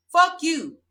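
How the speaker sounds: defiant curse at her critics; angry outburst. Real, not acted. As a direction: spit it at them. Heated rant at judgers.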